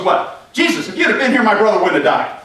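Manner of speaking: loud, high, accusing voice, lightly acted; mock indignant scolding; scolding outburst. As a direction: tell him off as the upset sister. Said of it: "She's just letting him have it".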